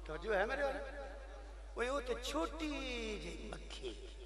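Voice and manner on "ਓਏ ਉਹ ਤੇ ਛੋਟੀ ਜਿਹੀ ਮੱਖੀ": soft, slow and drawn out; slow, softened preacher's aside; hushed emphasis. Real, not acted. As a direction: shrink the voice for something tiny. Stressing how tiny the fly is.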